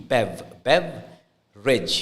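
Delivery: beverage drawn out slowly as bev-rij; bev-rij spelled out slowly; slow model word. Shown as a demonstration. Teacher slowly modelling beverage. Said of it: "Bev, B E V".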